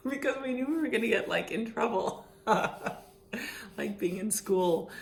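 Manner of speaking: fast, laughing through the words; words tumbling out in laughter. Real, not acted. Laughing while telling.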